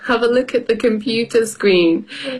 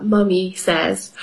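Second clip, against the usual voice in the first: ordinary delivery, nothing marked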